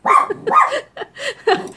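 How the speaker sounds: squealing high laugh; real giggling fit; high-pitched laughter. Real, not acted. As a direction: burst out laughing at the joke. Cracks up at viewer joke.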